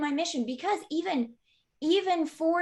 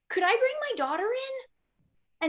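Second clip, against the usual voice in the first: much higher, sugary request; sweet asking voice; 10 semitones higher than usual. Retold or imitated. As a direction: ask sweetly, like begging a favour. Sweetly asking another chiropractor.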